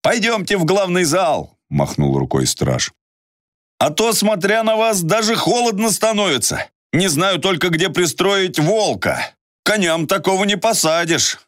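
high, lightly voiced guard; a guard showing them in; friendly guard voice.

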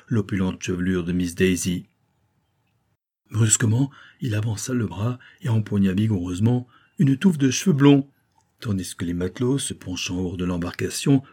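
plain reading voice

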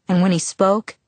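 ordinary delivery

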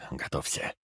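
breathy hushed whisper. A narrator's reading. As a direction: whisper it close to the ear. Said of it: "шёпотом сказал он".

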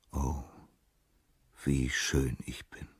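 soft, slow, self-adoring murmur; self-enchanted. A narrator's reading. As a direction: savour each word softly. Narcissus admiring his reflection.

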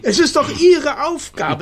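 high, loud and fast urging; insistent. Acted. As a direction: push him hard. Mayor presses the poet.

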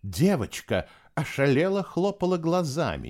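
plain reading voice

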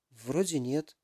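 a touch of a hushed, timid reply; mild whisper. A narrator's reading. Alisa answers the worried professor, mildly hushed. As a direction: say it barely above a breath. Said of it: "прошептала Алиса".

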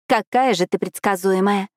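ordinary delivery

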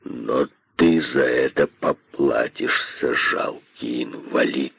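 very low, soft, slow threat; menacing. A narrator's reading. Great python promises Косой punishment, menacing. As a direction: sink the voice and threaten slowly. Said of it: "сказал он грозно".

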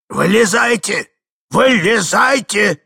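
громкий протяжный приказ; требуй громко, растягивая слово; крик старика. A narrator's reading. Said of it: "кричал старик". (гневный стариковский окрик)

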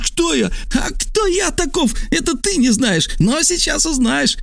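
very high, strained yelling; two men yelling while held by the throat; shouting. A narrator's reading. Rivals accuse each other, throats gripped, high-pitched shouting. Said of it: "Они держали друг друга за горло и оба кричали".

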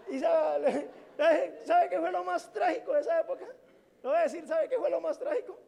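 hablando entre risas, alto y fuerte; riendo. Real, not acted. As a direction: cuenta su tragedia riéndose. Se ríe de su tragedia. (se ríe de su desengaño mientras cuenta)

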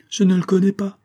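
high, loud protest; woman's urgent exclamation; cried out. A narrator's reading. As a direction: cry out the denial. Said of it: "cria la femme".

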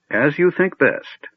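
slow, high and gentle; gentle old wizard. Acted. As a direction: accept it kindly, slowly. Fizban gentle acceptance.

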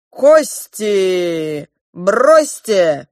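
loud, stretched ritual command; high priest's proclamation. A narrator's reading. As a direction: proclaim it like a priest mid-ceremony. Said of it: "возвестил великий жрец".

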